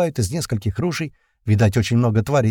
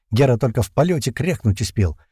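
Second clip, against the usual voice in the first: usual speaking voice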